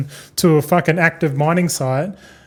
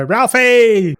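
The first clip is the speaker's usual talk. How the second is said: high, loud call of Ralphie; calling out a name; 6 semitones higher, 4 dB louder than usual. Retold or imitated. Calling out to a little workmate, loud and cheerful. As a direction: holler it across the workshop like old times. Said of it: "I used to always go".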